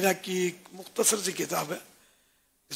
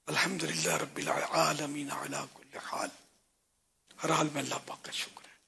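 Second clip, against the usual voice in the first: whispered praise; 4 semitones lower, 8 dB quieter than usual. Real, not acted. Scholar's humble devotional aside.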